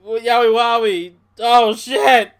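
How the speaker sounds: high, loud mock exclamation; yowie wowie. Bray reacting loudly to the boss. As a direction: blurt it out like a gleeful host. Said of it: "Bray's like".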